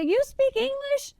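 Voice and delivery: high, slow incredulous question; amazed someone speaks English; surprised. Retold or imitated. Lost girl asks the stranger, high and amazed. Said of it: "And I say".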